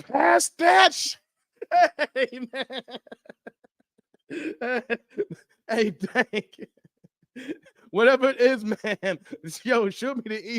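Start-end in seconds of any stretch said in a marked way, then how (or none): 0.0-6.7 high, slow, strained croak; mock dying gasp. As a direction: act out choking mid-seizure. Reenacting convulsing movie character.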